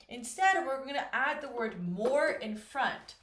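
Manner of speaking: ordinary talk, nothing marked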